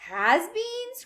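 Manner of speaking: very high, questioning squeal; incredulous cry. A narrator's reading. Violet puzzled by the sign, crying out. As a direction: echo it in high disbelief. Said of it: "cried Vi-, Violet Beauregarde".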